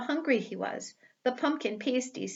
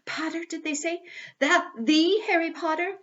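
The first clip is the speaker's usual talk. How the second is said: whispering crowd; 7 semitones higher, 4 dB louder than usual. Acted. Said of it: "the whispers suddenly broke out".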